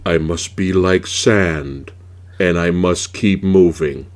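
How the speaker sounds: slow, low, mock-dramatic delivery; mock solemn hero line. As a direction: ham up a brooding action hero, slowly. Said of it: "and then he goes".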